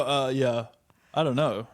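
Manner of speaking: ordinary delivery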